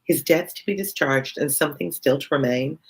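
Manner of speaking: ordinary talk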